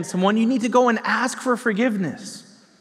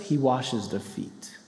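soft emphasis; 9 semitones lower, 8 dB quieter, slower than usual. Acted. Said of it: "But what does he do first?".

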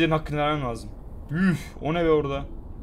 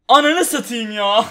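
frustrated laugh; 6 semitones higher, 7 dB louder, slower than usual. Real, not acted. Gamer laughing after near catch.

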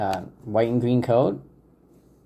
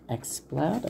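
"Exploud" softly rising into a question; unsure pronunciation; 6 semitones higher, 7 dB quieter than usual. Real, not acted. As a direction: try out "Exploud" as a tentative, higher question. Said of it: "I guess you pronounce it like that".

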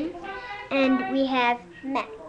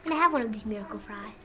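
usual speaking voice

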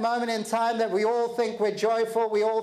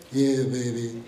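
put-on much lower, deflated "Yeah, baby" dropping flat; glum attitude; 10 semitones lower than usual. Shown as a demonstration. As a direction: mumble "Yeah, baby" with zero energy. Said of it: "Or is it".

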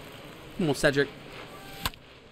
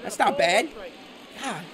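ordinary delivery

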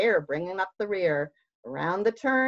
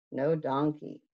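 ordinary delivery, nothing marked

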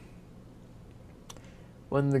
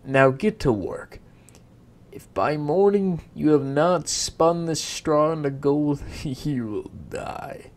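menacing king; 3 semitones higher, 9 dB louder than usual. Acted. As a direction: threaten her, then chuckle darkly. King threatens the girl.

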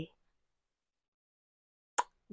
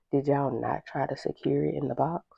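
usual speaking voice

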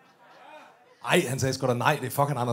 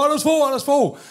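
high calling out a name; 8 semitones higher than usual. Retold or imitated. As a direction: shout his name across the street. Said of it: "Råber".

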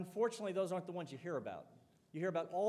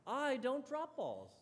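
imitating an indignant proud athlete, slow and high; prideful; 7 semitones higher, slower than usual. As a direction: protest with wounded pride, drawn out. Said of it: "caught up in a thing called pride".

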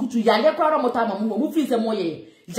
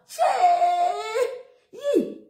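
high mock shriek; 10 semitones higher than usual. Real, not acted. Mocking exclamation of disbelief.

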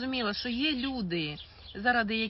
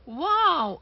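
excited wow; 8 semitones higher, 8 dB louder than usual. Pretend. Marvelling at the cell.

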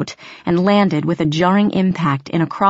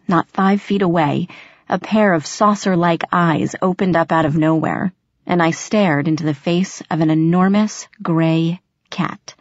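ordinary delivery, nothing marked

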